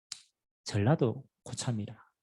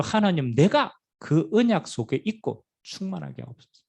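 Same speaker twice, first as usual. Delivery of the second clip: loud, high, pleading cry to God; loud impassioned plea for the Spirit; fervent prayer; 4 semitones higher, 4 dB louder than usual. Pretend. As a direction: cry the prayer out with full force.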